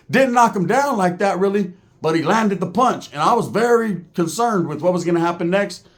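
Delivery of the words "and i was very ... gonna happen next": normal talking voice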